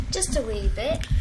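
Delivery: a hint of a Scottish lilt on wee bit; light Scottish accent. A hint of a Scottish accent, teasing her cohost. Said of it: "Oh, I like your accent".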